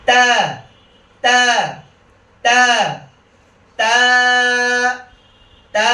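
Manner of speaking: ringing, thin "তা"; lightly spread lips. Shown as a demonstration. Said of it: "একটু হালকা ছড়িয়ে দিয়ে".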